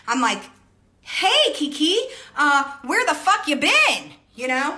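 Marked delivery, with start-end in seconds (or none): 1.0-4.1 high, loud, slow confrontational yell; yelling at the coworker who strolled in late; mock yell. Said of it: "No, not really like that".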